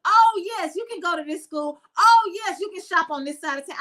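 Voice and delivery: lightly mocked granting of permission, loud high "Oh, yes"; imagined permission-giver. Speaker imitates someone granting permission to live and shop, lightly mocking. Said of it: "I don't need you to say".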